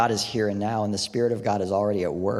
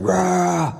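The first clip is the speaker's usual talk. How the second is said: loud, higher death metal "rah"; death metal roar; 9 semitones higher, 7 dB louder than usual. Retold or imitated. Preacher imitating Slipknot at full volume, loud roar. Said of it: "turns up some sort of death metal".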